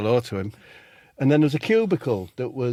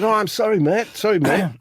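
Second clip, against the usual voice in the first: higher-pitched apology; a flustered, higher "sorry mate"; apologetic; 8 semitones higher, 4 dB louder than usual. Retold or imitated. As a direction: blurt out an embarrassed apology. Acted apology to man in cubicle.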